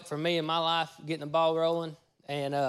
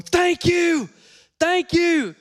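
very high, loud strained imitation; loud, high-pitched imitation of an overjoyed man; imitated handicapped man; 10 dB louder, much slower than usual. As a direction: shout it high in another man's put-on voice. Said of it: "He, he talked like this".

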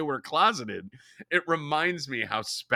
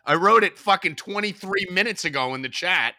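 louder, higher, exasperated; annoyed scolding; 7 semitones higher, 6 dB louder than usual. Real, not acted. Host scolding cohost for ignoring his message, annoyed and louder. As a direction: snap at him for ignoring you.

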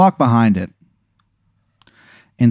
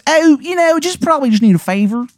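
very high, badly faked Maryland accent; a hammed-up Maryland accent that can't land; terrible Maryland accent. Game master voicing Shithead Steve to Aaron, awful Maryland accent. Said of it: "he doesn't know how to do the accent".

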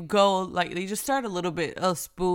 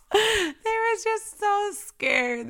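said through high, slow laughter; laughing; 3 dB louder, much slower than usual. Real, not acted. Mom recalling her scared kids, laughing through it. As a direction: laugh while you say it.

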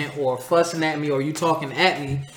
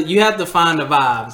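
ordinary delivery

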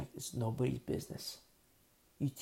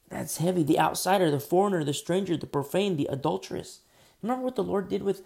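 loud, driving list of outcasts; preaching at full force; 5 semitones higher, 8 dB louder than usual. Real, not acted. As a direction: thunder out each word of the list.

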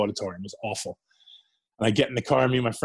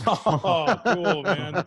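laughing through the words, pitched high; laughing delight; 5 semitones higher, 3 dB louder, much slower than usual. Retold or imitated. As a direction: retell his giddy joy with a laugh. Reacting to getting hired.

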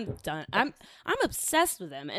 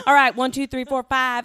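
put-on North Carolina accent; 3 semitones higher, 9 dB louder than usual. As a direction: count off like North Carolina cousins, laughing as you start. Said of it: "they had that North Carolina accent".

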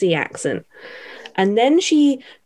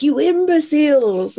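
mock Cruella; 7 semitones higher than usual. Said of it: "she's like".

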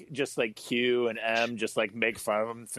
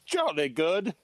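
put-on British accent; 6 semitones higher, slower than usual. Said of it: "I do a lot of British accents".

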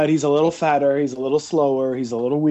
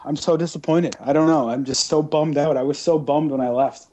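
ordinary talk

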